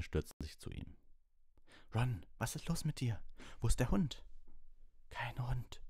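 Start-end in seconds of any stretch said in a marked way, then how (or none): 1.9-4.2 high panicked questions; alarmed. Acted. Panicked question to injured friend. 5.1-5.8 pained whispered groan. Acted. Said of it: "stöhnte Ron".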